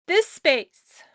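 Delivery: words jolted by a hiccup. Real, not acted. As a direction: say it as a hiccup hits. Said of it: "pardon me, I have the hiccups".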